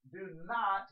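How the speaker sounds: "not" hit high and loud; stress on "not". Shown as a demonstration. Preacher reading Jesus's words, "not" strongly stressed. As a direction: punch "not" as the key word. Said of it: "there's the word not".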